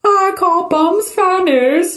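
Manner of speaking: high, very slow mock British; British accent. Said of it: "This is my British accent".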